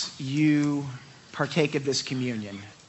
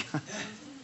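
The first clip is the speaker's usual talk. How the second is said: soft laugh; 8 semitones higher, 10 dB quieter than usual. Real, not acted. Laughs at own over-teaching.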